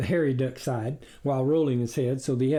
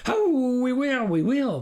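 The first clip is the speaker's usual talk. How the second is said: high, loud yapping; yapped; 11 semitones higher, 4 dB louder than usual. Acted. Critter yapping at the farmer's wife, high eager yap. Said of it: "the critter yapped".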